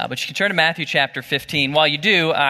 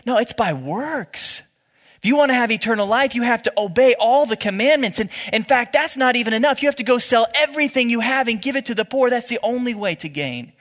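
raised pitch, relentless insistent argument; emphatic insistence; 7 semitones higher than usual. Acted. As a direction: drive each demand home, pitched high. Voicing Jesus as demanding works, for argument.

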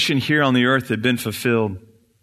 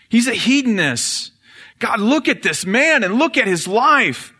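much higher, louder shrill-edged accuser; Satan accusing; 11 semitones higher, 4 dB louder than usual. Acted. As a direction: shriek Solomon's faults up at God. Satan accusing King Solomon.

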